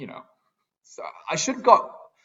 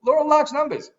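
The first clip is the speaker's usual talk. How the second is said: mocking viewers; 13 semitones higher, 3 dB louder than usual. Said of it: "you're all saying".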